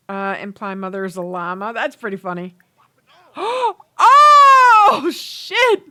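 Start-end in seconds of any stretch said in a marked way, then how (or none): 3.3-4.8 loud, high gasp of shock; sharp real gasp as something wild happens; startled cry at a drink thrown in someone's face; shocked gasp shout. Real, not acted. Shock at a game event. 4.9-5.8 high, loud real shock; stunned reaction to a fight breaking out. Real, not acted. Shock at a game event.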